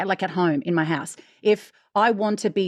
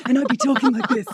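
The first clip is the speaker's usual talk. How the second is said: hoarse party voice; 6 semitones higher, 3 dB louder than usual. Shown as a demonstration. Voice coach showing her hoarse hungover voice. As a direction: talk through a wrecked voice. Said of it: "my voice would be down here".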